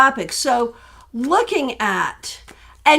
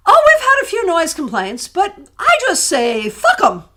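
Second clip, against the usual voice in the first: higher-pitched imitation British accent; poor British accent; 6 semitones higher, 5 dB louder than usual. Retelling the front desk woman's reply to noise complaints, imitated British accent. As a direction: put on a not-so-good British accent. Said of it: "I can't do a good British accent".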